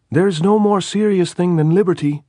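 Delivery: high, loud fanatic shout. A narrator's reading. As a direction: yell it like a slogan. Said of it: "the bomb man shouted".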